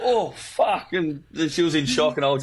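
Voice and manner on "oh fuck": high, startled yelp of surprise; imitating her stunned gasp; shocked cry. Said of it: "she's just like".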